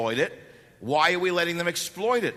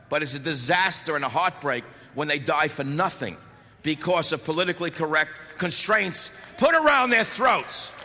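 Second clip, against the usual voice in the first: ordinary delivery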